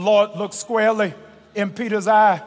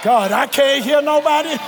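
preaching at full force; 9 semitones higher, 3 dB louder than usual. Real, not acted. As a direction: pitch climbing to a stretched-out shout. Preaching climbs to a peak.

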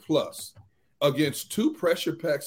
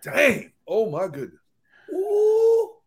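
mock amazement rising to a high "ooh"; imitated awed reaction; 10 semitones higher, 3 dB louder, slower than usual. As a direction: play an awestruck onlooker, ending in a high hoot. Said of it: "gonna be sitting there tonight ... talking about".

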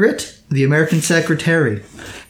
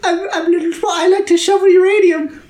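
eager tiny man voice; high and keen, like a small man; high squeaky little man. Acted.

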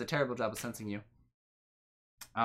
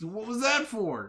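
higher, louder, drawn-out complaint; indignant protest; 9 semitones higher, 6 dB louder than usual. Acted. Game master replays smacked Wilson's reaction, loud indignant protest. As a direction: stretch out the wounded protest.

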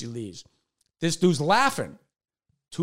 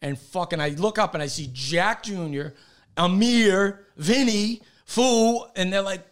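ordinary talk, nothing marked

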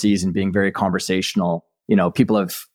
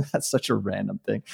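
softer, laughing self-quote; retold laughing aside; 8 dB quieter than usual. Executive under the boardroom table to himself, amused laughing aside. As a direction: recall it through a light, quiet laugh. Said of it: "I just started dying laughing".

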